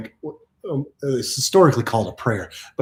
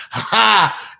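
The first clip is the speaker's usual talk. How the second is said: giddy laugh; 5 semitones higher than usual. Real, not acted. Getting all giddy in the motion ocean, giddy laugh. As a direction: burst out in a delighted laugh.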